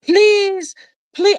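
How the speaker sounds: high, loud mock begging; pleading lady. Lady phoning about her dress, pleading. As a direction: plead desperately as the caller. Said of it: "and it's the lady".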